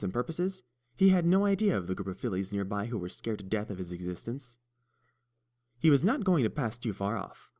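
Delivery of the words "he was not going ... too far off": ordinary delivery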